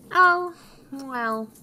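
high, loud grudging concession; exaggerated little-man reluctance; yellow dwarf. A narrator's reading. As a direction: give in as a put-upon dwarf. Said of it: "he replied".

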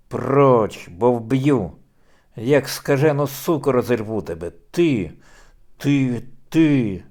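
enraged husband shouting at his wife; furious shout. Acted. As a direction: roar them as if about to strike. Kochubei threatens his wife in rage.

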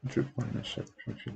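very softly murmured reading; reading to oneself. Real, not acted. Trainer proofreading a poem line by line, murmured to self. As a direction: read it barely audibly, as if to yourself.

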